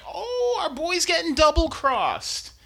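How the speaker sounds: a put-upon high whimper; mock high whine. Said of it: "it's like".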